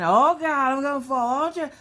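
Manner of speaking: high, loud frightened cry while slipping; panicked yelp. Retold or imitated. Mom slipping on the rocks, squealing in mock panic. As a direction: squeal it like you're losing your footing. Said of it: "I'm just all over the place".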